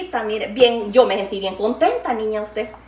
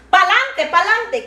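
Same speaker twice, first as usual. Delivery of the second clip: cheering on; 8 semitones higher, 4 dB louder, much slower than usual. Real, not acted. Cheering viewers on.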